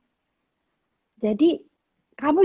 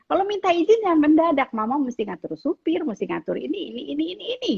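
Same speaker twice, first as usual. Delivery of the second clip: scolding mother; 6 semitones higher, faster than usual. Retold or imitated. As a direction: lecture the child in exasperation. (omelan ibu, tinggi dan keras)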